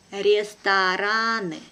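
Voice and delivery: slow and careful, drawn out for learners; teacher's careful slow reading. Shown as a demonstration. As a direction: spell it out slowly for the class. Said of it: "one first time will be slow".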